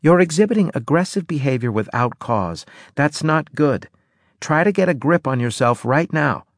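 firm, clinical warning voice; a calm but stern medic; stern woman. A narrator's reading. Anne's voice.

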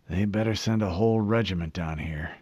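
ordinary delivery

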